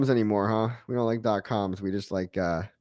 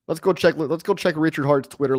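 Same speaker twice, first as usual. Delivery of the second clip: put-on Dutch accent; 5 semitones higher than usual. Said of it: "His accent's pretty good".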